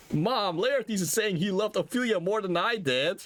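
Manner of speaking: high, tattling whine; high childish complaint to his mother; whiny son. Acted. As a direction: tattle to mom like a sulky kid. Hamlet whining to his mother.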